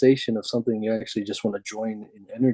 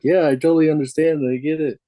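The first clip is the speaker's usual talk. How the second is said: louder, higher fake "I totally get it"; mock eager agreement; 5 semitones higher, 5 dB louder than usual. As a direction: play the listener who nods along without understanding. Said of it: "that's what people do, is like".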